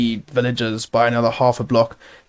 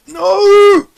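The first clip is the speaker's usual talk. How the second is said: high, loud cry of dismay; anguished outburst; 9 semitones higher, 5 dB louder, much slower than usual. Real, not acted. Player watching an explosion wreck his work, anguished outburst. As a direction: explode in frustration, dragging out the words.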